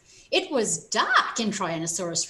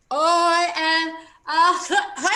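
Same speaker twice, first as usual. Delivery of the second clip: slow, high, laughing, muffled try; choked laughing words; mouthful of feathers; 6 semitones higher, 6 dB louder, much slower than usual. A narrator's reading. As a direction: talk through feathers. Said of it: "mouthful of feathers".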